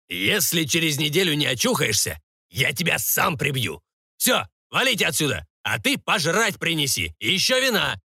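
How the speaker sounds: lightly acted irritable lord, pitch lifting on orders; threaten, then dismiss everyone; threatening baron. (слегка сыгранный раздражённый барон)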